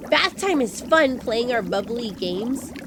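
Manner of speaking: ordinary delivery, nothing marked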